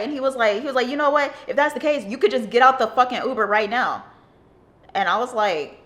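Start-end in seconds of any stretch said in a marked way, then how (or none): 0.9-4.0 angry. Retold or imitated. Driver kicks them out angrily.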